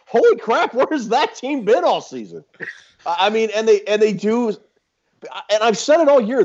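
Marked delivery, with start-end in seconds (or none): 0.1-2.4 excited disbelief. Retold or imitated. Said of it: "is when you sit up and go".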